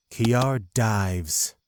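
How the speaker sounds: normal talking voice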